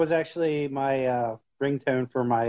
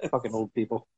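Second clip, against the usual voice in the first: ordinary talk, nothing marked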